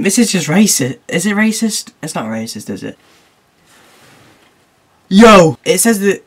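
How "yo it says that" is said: a loud, high burst of surprise; sudden excited yell; excited shout. Real, not acted. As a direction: yell it like you just spotted something. Sudden discovery while reading meme.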